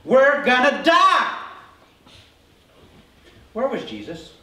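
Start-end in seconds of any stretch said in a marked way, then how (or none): 0.0-1.4 loud, high, drawn-out alarm; dramatic panicked wail; acted panic. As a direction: cry it out slowly like doomed sailors. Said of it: "They're worried. They're thinking".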